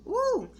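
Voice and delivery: closing high whoop, imitating the manager; high mock woo. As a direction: end the quote with a high woo. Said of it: "She was like".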